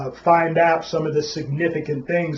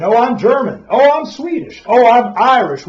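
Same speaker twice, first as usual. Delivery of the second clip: mock classmates; 7 semitones higher, 6 dB louder than usual.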